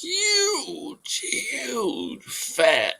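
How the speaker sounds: very high, drawn-out exaggeration; exaggerated huge. Real, not acted. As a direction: stretch it out way up high. Exaggerating the stranger's size.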